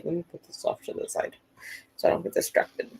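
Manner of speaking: unvoiced muttered aside; whispered aside. Real, not acted. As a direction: whisper it to yourself. Aside while moving things.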